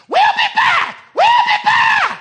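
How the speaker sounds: high, loud yell from the car window. Retold or imitated. Man hanging out of a car yelling to the preacher, loud high yell. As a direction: shout it back over your shoulder as you drive off.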